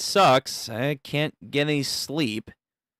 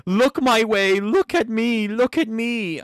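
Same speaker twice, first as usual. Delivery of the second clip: pleading fanboy; 6 semitones higher than usual. Acted. Fanboy begging for attention.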